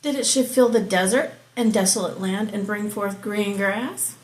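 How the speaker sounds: measured, declaiming Bible reading; scripture reading. Acted. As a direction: read it out like from the pulpit. Reads Job 38 aloud.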